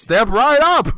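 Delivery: loud carnival barker. Acted. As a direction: bellow it like a fairground barker.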